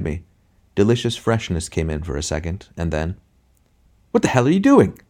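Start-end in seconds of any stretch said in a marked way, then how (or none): 4.1-5.0 high, loud alarmed shout; sharply raised, outraged yell. A narrator's reading. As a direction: shout to stop it at once. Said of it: "shouted the intelligent man".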